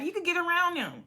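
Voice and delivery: high-pitched imitation of egging someone on; mock urging. Mimicking Meatball answering the security excuse, mock urging. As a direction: pitch it up, mimicking a pushy friend.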